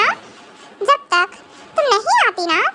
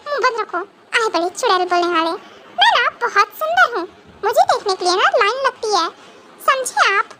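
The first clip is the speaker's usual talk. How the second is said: ordinary talk, nothing marked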